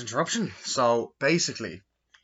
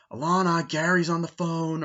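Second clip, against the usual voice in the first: higher and louder, call across the house; mock calling out; 7 semitones higher, 7 dB louder than usual. Sister calling Alana to the phone, mock call across the house. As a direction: shout the name to another room.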